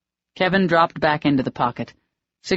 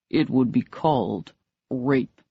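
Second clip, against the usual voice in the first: low and dangerous; 3 semitones lower than usual. A narrator's reading. Furious man naming what she did, low and dangerous. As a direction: let the threat sit low and slow. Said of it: "his voice grew low and dangerous".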